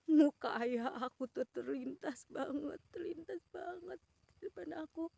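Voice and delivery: ordinary talk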